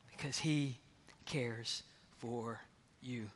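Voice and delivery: soft, slow whispered reassurance; tender whisper. Retold or imitated. Peter reassuring a suffering friend, soft and slow. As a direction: whisper it gently, as comfort.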